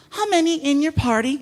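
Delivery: a touch of a chirpy host's lilt; restaurant host. Acted. Host asks arriving guests for party size, lightly voiced host.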